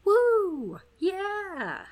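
high excited whoop; cheering. Real, not acted. Cheering patron.

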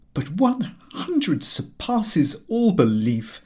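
slow, wondering disbelief; amazed. A narrator's reading. Clerk marvelling at his hundred-pound raise, slow and amazed. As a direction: savor the impossible sum slowly.

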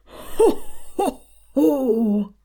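slow, breathy belly-laugh exercise; Christmas ho-ho-ho. Shown as a demonstration. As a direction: pump out three drawn-out ho's. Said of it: "Christmas ho ho ho's".

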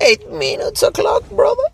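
high, slow put-on English voice; hammed-up goofy English line; drawn-out joke English, high up; mock English. Joking English aside to friends.

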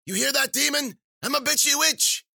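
very high, loud witch's shriek; screechy, overjoyed crone; guffawing glee. Acted. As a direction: crow the joke to the pet. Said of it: "She guffawed again".

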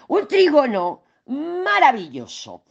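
high, slow, swooning praise; a dreamy high swoon over the word maravilloso; gushing delight. Real, not acted. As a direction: sing out how marvellous it is. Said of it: "delighted about the trine".

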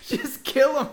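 laughing cheer for violence; laughing egging on. Real, not acted. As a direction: urge him on through laughter.

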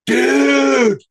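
loud high excited yell of recognition; excited shout. Retold or imitated. Customs officer shouting at the voice actor, thrilled. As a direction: belt it out like a starstruck fan.

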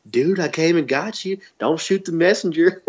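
high-pitched mock Southern protest; Mideon's Southern voice. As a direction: plead your innocence in a hammed-up Southern accent. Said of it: "Mideon's like".

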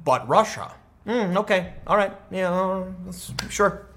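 lightly voiced, hesitant shrugging reply; a hint of a fumbling guest, mocked. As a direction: brush it off like a pundit dodging the point. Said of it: "And this guy goes".

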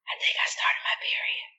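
geflüstert ins Telefon; hushed whisper into the phone. Retold or imitated. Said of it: "I whispered into the phone".

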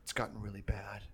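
flat, hushed and grave, dropping to a whisper; stoic hush. Retold or imitated. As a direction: confide it like a terrible secret. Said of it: "very stoic".

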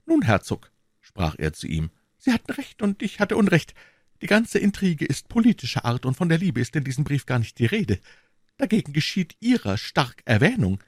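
lightly voiced pleased king; a relieved king admitting he was wrong; king's voice. A narrator's reading.

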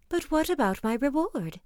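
high, worried calling crane; anxious crane. A narrator's reading. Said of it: "called the crane anxiously".

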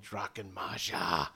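low, slow, voiceless imitation of a yell; hushed mock yell. Game master recalling the townspeople's yell, hushed mock shout. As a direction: imitate a distant yell under your breath. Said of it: "where the people had yelled".

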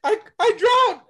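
high, loud imitation of bawling; mock tearful outburst. Son finally confesses to mom, mock bawling. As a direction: burst out in hammed-up tears. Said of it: "I just couldn't hold it anymore".

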